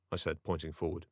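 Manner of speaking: usual speaking voice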